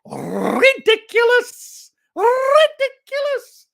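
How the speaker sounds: high, drawn-out squawk with rolled R; comically overblown, rolling disbelief; mock outrage. Mock outrage over fuel prices.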